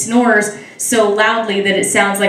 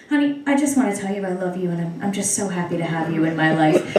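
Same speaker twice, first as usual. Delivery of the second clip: sweet husband; 4 semitones lower, 7 dB quieter, faster than usual. Acted. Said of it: "the only correct answer is".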